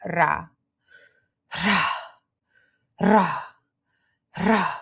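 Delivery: "ra" with a trilled R; "ra" on a relaxed tongue-tip trill; rolled R drill. Shown as a demonstration. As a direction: roll each "ra", leaving space to repeat. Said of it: "Now try saying".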